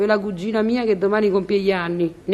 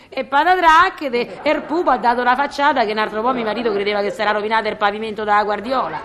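usual speaking voice

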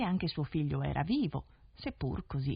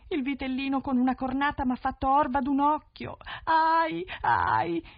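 high, loud hammed-up wail with a cry of pain; fake crying; 9 semitones higher, 6 dB louder than usual. One-eyed daughter to the prince, faking tearful pain. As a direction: put on a sobbing lie. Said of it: "la trovò coricata che piangeva".

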